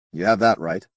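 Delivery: stiff, guarded reply. Acted. Rod answering the alien stiffly, uncomfortable being studied. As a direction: hold yourself in, a little rigid. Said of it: "Rod's voice was stiff despite himself".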